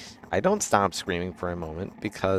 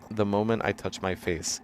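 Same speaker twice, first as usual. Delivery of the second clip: plain reading voice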